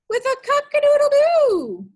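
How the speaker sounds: high, loud cock-a-doodle-doo crow; a rooster crowing at dawn. Acted.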